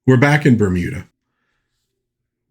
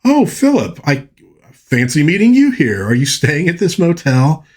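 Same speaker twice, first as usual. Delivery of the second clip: much higher, mock casual surprise; playing it off; 6 semitones higher than usual. Woman caught in phone booth greets Philip, feigning casual surprise. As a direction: fake a breezy hello to cover being caught. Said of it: "she tries to play it off like".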